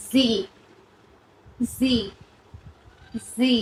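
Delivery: জি as English zee, without a final d; corrected pronunciation zee. Shown as a demonstration. Teacher correcting the last letter, zee pronunciation of জি. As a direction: model জি slowly, one at a time. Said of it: "আর লাস্টেরটা আমরা জেড বলি".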